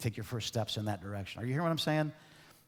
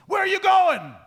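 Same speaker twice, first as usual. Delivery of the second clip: much higher, much louder furious boss yell; 12 dB louder than usual. Retold or imitated. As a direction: bark it like an ogre boss at an employee. Boss shouting quote repeated.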